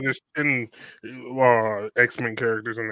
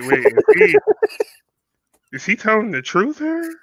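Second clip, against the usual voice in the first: high, squealed laugh through the question; laughing disbelief; 13 semitones higher, 5 dB louder than usual. Retold or imitated. Friend catching a deadpan joke late, laughing in disbelief. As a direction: ask it while breaking into laughter. Cracking up over delayed joke.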